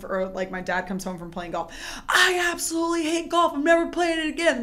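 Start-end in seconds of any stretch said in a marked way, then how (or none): 2.1-4.6 higher, louder grumpy golfer rant; mock fed-up dad. Dad home from bad round of golf, hammed-up fed-up rant. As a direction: rant like a sore loser off the course.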